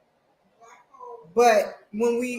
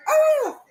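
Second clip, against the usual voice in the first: high angry yell of frustration; sudden high furious cry; mad scream; 7 dB louder than usual. Retold or imitated. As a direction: shriek like the mess just happened. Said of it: "I was so mad".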